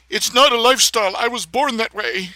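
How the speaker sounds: mocking effeminate voice. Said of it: "decided that homosexuality should mean you should talk like that".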